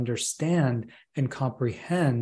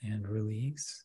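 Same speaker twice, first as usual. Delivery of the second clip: right in your ear. Acted. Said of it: "I want to take you up on that offer to do a process".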